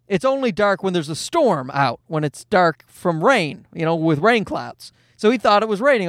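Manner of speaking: ordinary talk, nothing marked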